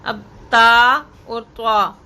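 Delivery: ता thin, त्वा full, drawn out; contrasting letters. Shown as a demonstration. Teacher contrasting two similar letters, slow. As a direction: set ता against त्वा slowly. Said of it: "इन दोनों आवाज़ों में फ़र्क करना".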